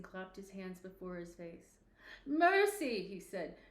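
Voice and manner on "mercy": high, loud terrified plea; cry for mercy. Acted. Scrooge on his knees before the ghost, loud terrified cry. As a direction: beg the ghost for mercy at full voice.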